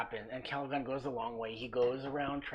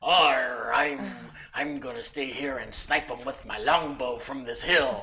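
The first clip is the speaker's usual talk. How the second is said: badly faked pirate-ish accent; uncertain pirate-like accent; 9 dB louder than usual. Player imitating ranger sniping from the hill, hammed-up botched accent. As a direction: open on a loud, growled "Arr" in a botched accent. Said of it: "don't even know what accent he does".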